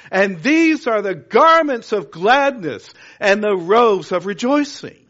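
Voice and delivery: preacher lifting the congregation with a big proclamation; full-force preaching. Acted. Rousing the church, preaching at full force.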